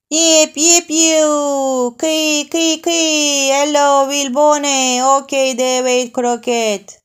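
high, loud playground whoops; excited children's war cries; cowboy game shouts. Acted. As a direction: yell like kids playing cowboys.

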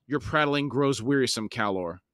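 steady, measured audiobook reading; story narration. A narrator's reading. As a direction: read it like an epic fantasy audiobook. Reads fantasy novel aloud.

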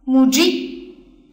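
母鸡 read slowly with clear syllables; stress demo. Shown as a demonstration. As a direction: sound out 母鸡 deliberately. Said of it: "所以读起来是这个样子的".

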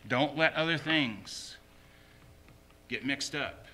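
normal talking voice

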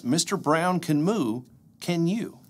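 bouncy, sing-song picture-book reading; lively storybook read-aloud; reading to children. Acted. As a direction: read it aloud like a bedtime story for little kids. Reads picture book to kids.

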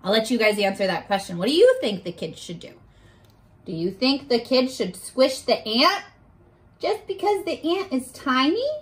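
usual speaking voice

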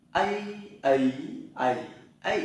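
"A" as an ei-like glide from e to i, four tones; vowel tone drill. Shown as a demonstration. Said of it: "A nhá". (đọc "A" qua bốn thanh điệu)

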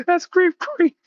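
very high, slow creature voice; laughing impression of a startled little gremlin; Gizmo. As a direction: squeak it, breaking into laughter. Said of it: "Gizmo stood back like".

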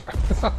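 high, loud panicked laugh; a burst of laughter in alarm; nervous laugh. Real, not acted. Panicked laugh while being chased.

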